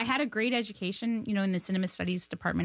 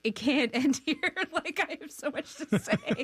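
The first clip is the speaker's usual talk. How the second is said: anxious gush; 8 semitones higher than usual. Retold or imitated. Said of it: "And I'm like".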